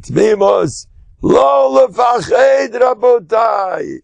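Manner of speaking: imitating a fiery sermon shout; preaching shout. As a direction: proclaim it to the whole congregation.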